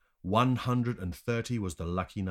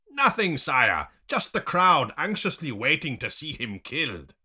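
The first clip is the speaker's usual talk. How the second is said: high, loud henchman voice; raised-pitch servant's report; reporting underling; 8 semitones higher, 7 dB louder than usual. A narrator's reading. Underling reports to his boss.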